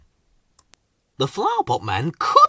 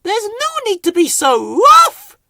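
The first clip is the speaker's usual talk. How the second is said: high, loud snappish character voice; snapped; 8 dB louder than usual. A narrator's reading. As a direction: snap it out, much higher and louder. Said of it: "she snapped".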